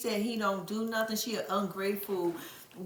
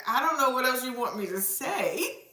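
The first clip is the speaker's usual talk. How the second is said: ordinary delivery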